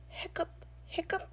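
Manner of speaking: high whispered hiccup sounds; monster hiccups. A narrator's reading. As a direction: squeak out two breathy hiccups.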